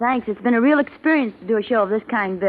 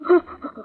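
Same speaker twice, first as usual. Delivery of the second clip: nervous laugh; 8 semitones higher than usual. Acted. Wife breaks down over suicide pact.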